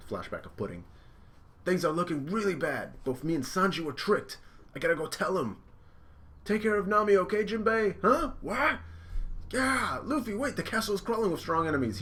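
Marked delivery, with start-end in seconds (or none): 1.6-12.0 much higher, louder cartoon voice; high shouting anime hero; urgent Luffy. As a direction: rush it out in alarm. Luffy then Nami voiced urgently.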